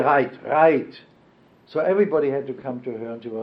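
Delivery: ordinary delivery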